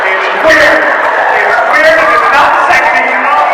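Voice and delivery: high, loud, worked-up protest; heated rant. Real, not acted. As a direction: rant it like a fan who's appalled. Heated rant about the ship.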